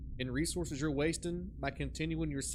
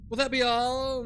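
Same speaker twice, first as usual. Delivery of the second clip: mock barista; 7 semitones higher, 8 dB louder than usual. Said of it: "this girl at Starbucks today is like".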